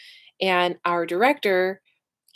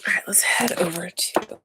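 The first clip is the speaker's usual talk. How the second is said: whisper. Real, not acted. Muttering to herself while fixing stream.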